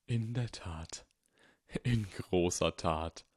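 put-on old wizard, hushed and grave; voiced old Dumbledore. Dumbledore warning Harry, hushed old wizard voice.

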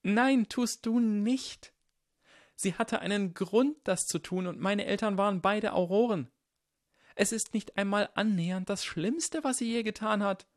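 high, upset, quivering protest; trembling voice. Acted. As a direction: contradict him with a high, shaking voice. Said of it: "Nevilles Stimme bebte".

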